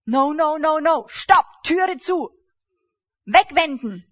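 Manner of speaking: loud, high, firm rejection; preacher shutting the door on worry, loud and firm; loud refusal. Acted. Slam the door, loudly.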